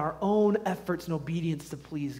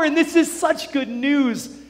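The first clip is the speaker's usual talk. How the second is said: high-pitched, driving sermon crescendo; fervent preaching; 7 semitones higher, 6 dB louder than usual. Real, not acted. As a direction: pile up the phrases with mounting conviction. Preaching at full force.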